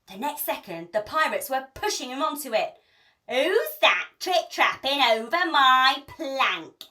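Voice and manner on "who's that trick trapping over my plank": jeering. A narrator's reading. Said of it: "jeered Hank Chief".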